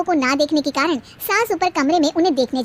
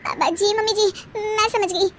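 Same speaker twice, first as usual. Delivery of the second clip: meek agreement; 8 semitones higher than usual. Acted. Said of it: "डर के मारे तीनों हामी भर देती हैं".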